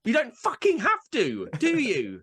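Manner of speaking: high, exasperated rant; wound up. Real, not acted. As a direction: snap back, pitch climbing with irritation. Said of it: "I don't know why that winds me up".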